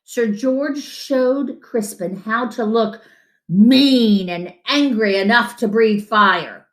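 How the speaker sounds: ordinary delivery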